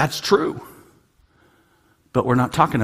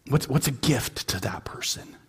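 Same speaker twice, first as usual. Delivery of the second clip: whispered thought; 8 dB quieter than usual. Retold or imitated. Inner thought quoted.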